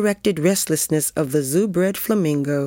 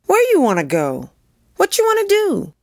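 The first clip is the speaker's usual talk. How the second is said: put-on child's voice rising much higher on the second question; young girl answering; 3 dB louder than usual. A narrator's reading. Lizbeth coming reluctantly from her private world, calling back. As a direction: call back like a kid, climbing high.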